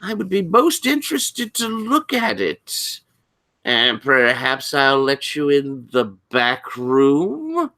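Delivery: high, interested librarian, slowing enticingly; Doctor Pritchard. Acted. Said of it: "Pritchard looking interested".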